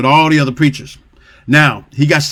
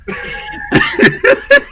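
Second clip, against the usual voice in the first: high laugh. Real, not acted. Laughs at joke.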